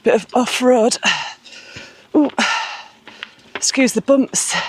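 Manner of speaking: usual speaking voice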